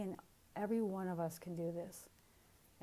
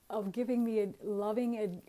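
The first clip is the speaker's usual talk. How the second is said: usual speaking voice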